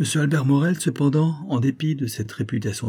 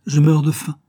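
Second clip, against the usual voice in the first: higher, louder outburst; hungry and insistent, voice raised; emphatic complaint; 6 semitones higher, 6 dB louder than usual. A narrator's reading. Victor tells the family he is starving, emphatic complaint. Hungry son clamouring for supper.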